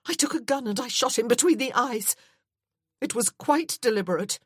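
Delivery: high, loud, desperate outburst; shouting confession. Acted. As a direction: keep yelling it at him. She goes on shouting her confession.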